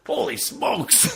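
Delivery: high, drawn-out comic exclamation; mock astonishment. Stan Lee under a lifted mask, high mock astonishment. Acting Stan Lee's startled cameo.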